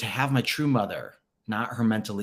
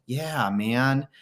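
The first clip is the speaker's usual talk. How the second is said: usual speaking voice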